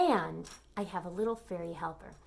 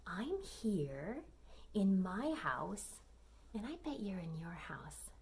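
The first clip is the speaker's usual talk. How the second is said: whispering; 3 semitones lower, 7 dB quieter than usual. Acted. Hushed intro to children.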